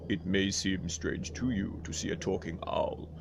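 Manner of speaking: low, soft owl voice; Aulian owl voice. Acted. The Aulian introducing himself to viewers, low and soft. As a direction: go low and quiet like a wise owl.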